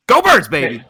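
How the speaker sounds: loud, excited fan shout; big loud cheer for the team. Real, not acted. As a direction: shout it out as a rallying sign-off.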